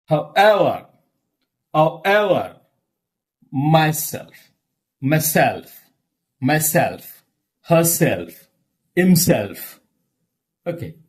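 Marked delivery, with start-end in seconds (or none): 0.0-9.8 "However" as how-EVER, "myself" as my-SELF; stress on second part. Shown as a demonstration. Teacher drills words ending in ever and self, ending stressed. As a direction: lean on EVER and SELF, falling after. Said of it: "words end with".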